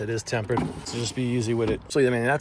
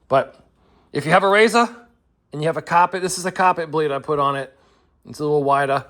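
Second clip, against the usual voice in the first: r-dropping Boston accent, a bit louder; 3 semitones higher, 5 dB louder than usual. Shown as a demonstration. Boston accent, showing viewers how he really sounds. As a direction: drop the R's in a thick Boston accent. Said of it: "there's your dose of Boston accent".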